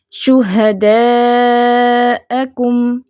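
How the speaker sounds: شہداءکم drawn out very slowly with a clean glottal hamza; slow tajweed recitation; Quranic recitation. Shown as a demonstration. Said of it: "حمزہ کو عین نہیں بنانا".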